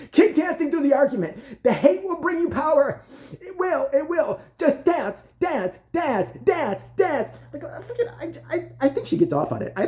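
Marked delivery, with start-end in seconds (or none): 0.1-7.3 evil coach in a high, driving voice; Abby Lee Miller. Retold or imitated. Evil coach driving girls through the argument, high mock menace. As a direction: chant the orders with mock menace, pitched up. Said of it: "evil Abby Lee Miller over there".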